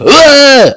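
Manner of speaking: high imitated scream; mock scream. Reviewer retelling Braun's snakebite, mock scream. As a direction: cry out in a put-on scream. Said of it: "He screams".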